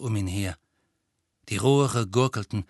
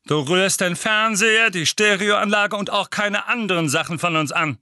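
higher, louder bullying uncle; gruff uncle voice; 8 semitones higher, 6 dB louder than usual. Acted. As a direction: bark orders like a bossy uncle. Uncle Vernon threatens Harry.

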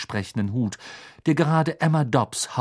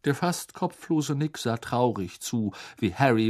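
ordinary delivery, nothing marked

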